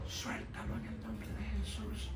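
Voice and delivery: acting out a lost voice, barely audible; slow, very soft strained croak; voiceless. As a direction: force the words out with almost no voice. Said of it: "porque no puedo hablar".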